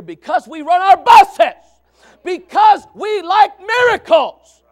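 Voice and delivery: high, very loud, stretched-out proclamation; full-force preaching shout. Real, not acted. Preacher telling congregation why kids came, full-force shout. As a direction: hammer each word out slowly at full volume.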